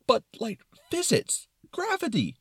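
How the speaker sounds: high, loud fretting; concerned Brain. Acted. Brain protesting about physics, concerned and high. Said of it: "in a very concerned tone".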